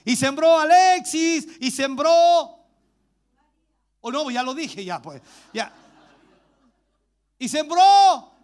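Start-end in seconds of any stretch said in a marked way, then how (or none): 0.1-2.6 loud, high, drawn-out proclamation; llamado a viva voz. Real, not acted. Calling out for congregation's names. 7.4-8.3 loud, high, drawn-out proclamation; llamado a viva voz. Real, not acted. Prompts congregation to shout names.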